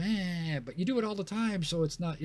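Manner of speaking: high-pitched mock dismissal; dismissive objection. Voicing people dismissing praise for bravery.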